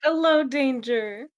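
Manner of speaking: slow, high, loud hello; drawn-out greeting. Real, not acted. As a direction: call out a big hello. Greeting a newcomer.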